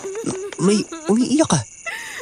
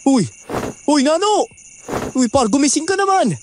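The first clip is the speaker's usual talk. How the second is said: calling out; 10 semitones higher than usual. Acted. Trying to wake passed-out friend.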